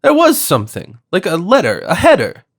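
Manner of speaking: high, loud excited cry; excited shout. A narrator's reading. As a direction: blurt it out as the memory hits. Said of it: "and then shouted".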